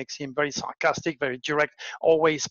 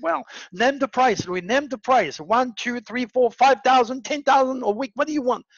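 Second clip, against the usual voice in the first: higher and louder, building as the numbers climb; eager bidding; 8 semitones higher, 4 dB louder than usual. Retold or imitated. The rich friend pressing him to name his price, climbing and eager. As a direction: push the offer harder with each number.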